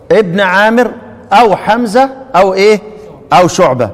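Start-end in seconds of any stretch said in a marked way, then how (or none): none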